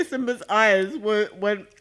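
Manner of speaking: talking through a mouthful; words round a bite of food; mouth full. Real, not acted. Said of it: "I'm talking with my mouth full".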